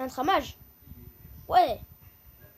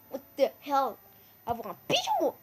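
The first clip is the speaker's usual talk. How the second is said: usual speaking voice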